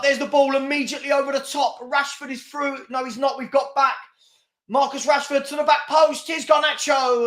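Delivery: quick, lifted match commentary; live play-by-play. Acted. Fan calling the counterattack for his viewers, excited commentary. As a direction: call the attack as it breaks.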